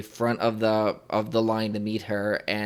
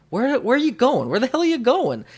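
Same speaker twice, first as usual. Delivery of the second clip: much higher and louder, playful indignant demand; mock outraged star; 6 dB louder than usual. Actress stops the fan from leaving, playfully outraged high call. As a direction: squeal it like a delighted star calling him back. Retelling the actress stopping him.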